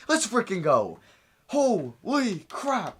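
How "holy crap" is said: slow, higher, drawn out; awestruck exclamation. Real, not acted. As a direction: savour the disbelief. Amazed at team ratings.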